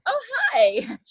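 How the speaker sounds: high, bright fake-friendly hi; put-on sweet greeting. Actress greeting Joy Behar with a put-on sweet hi. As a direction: mask the irritation with a sugary hello. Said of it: "put on".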